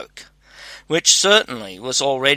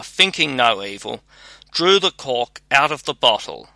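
ordinary delivery, nothing marked

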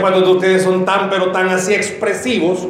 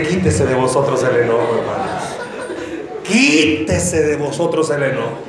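solemn quote; 8 semitones lower than usual. Acted. Preacher quoting scripture.

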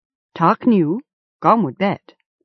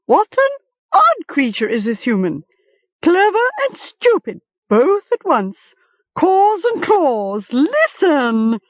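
high, squawking scorn, slowing at the end; a bird scoffing at human folly; disgusted raven; 11 semitones higher than usual. A narrator's reading. Raven mocking Gom in disgust.

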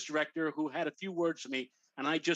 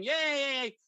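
retold crowd cheer; 9 semitones higher, 3 dB louder than usual. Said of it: "kissing, screaming".

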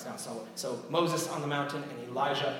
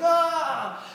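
high, loud imitated yell; mock yelling; 14 semitones higher, 9 dB louder than usual. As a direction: cry out like desperate prophets calling their god. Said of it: "still yelling loud".

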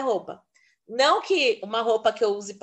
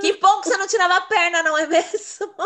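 fast, high, loud laughing quip; 8 semitones higher, 6 dB louder, faster than usual. Real, not acted. Joking back to her friend about keeping her leg, laughing. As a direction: tease her through real laughter.

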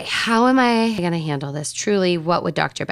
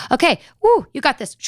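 self pep talk; 8 semitones higher, 4 dB louder than usual. Retold or imitated. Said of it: "This is my self-talk".

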